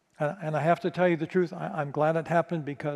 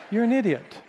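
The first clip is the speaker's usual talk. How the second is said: higher, louder, scornful insult; emphatic scorn; 6 semitones higher, 4 dB louder than usual. Retold or imitated. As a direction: hammer home the insult. Repeats insult with rising force.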